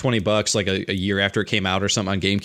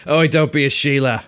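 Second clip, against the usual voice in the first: playful Australian ribbing; goofy Australian accent; 2 semitones higher, 4 dB louder than usual. Retold or imitated. Joking with a friend about the accent, goofy Australian accent. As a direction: put on a broad Aussie accent. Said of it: "that goofy accent".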